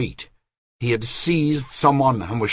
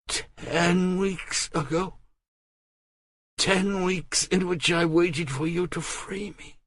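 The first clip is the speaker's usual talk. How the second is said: weary Marguerite; 10 semitones higher, 4 dB quieter than usual. Acted. Marguerite tells Robert she waited, higher and subdued. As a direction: let the long wait weigh on it.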